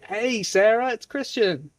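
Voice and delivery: high, bright hello with a rising name; friendly greeting. Shown as a demonstration. Tutor modelling the call, cheerful.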